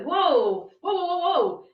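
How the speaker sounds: high, loud drawn-out whoas; mock startled whoa. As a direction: pull back with a startled whoa. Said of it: "I can go".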